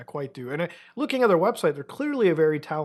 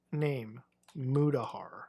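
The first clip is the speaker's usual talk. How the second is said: slow, soft, word by word; slow dictating; 4 semitones lower, 9 dB quieter, much slower than usual. Real, not acted. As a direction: say it as you type it in. Types and says entries slowly.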